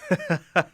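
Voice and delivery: dry in-character chuckle; chuckle to himself. Acted. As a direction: chuckle as if privately amused. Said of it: "kind of chuckles to himself".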